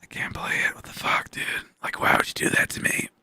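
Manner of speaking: whispered, exasperated private thought; hushed whispered protest, no voice; inner complaint. Retold or imitated. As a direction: whisper it to yourself, aggrieved. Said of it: "deep down, I'm like".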